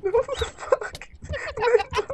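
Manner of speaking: outraged at the loss; shocked outcry. Real, not acted. Friend killed in game.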